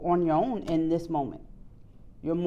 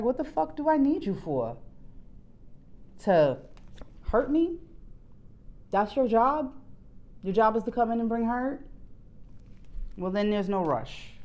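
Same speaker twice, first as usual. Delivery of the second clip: higher-pitched incredulous questions, then settling; indignant realization; 5 semitones higher than usual. Acted. Viewer telling off a would-be partner, acted indignation. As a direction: act out dismissing someone unneeded. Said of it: "when you have that realization, it was like".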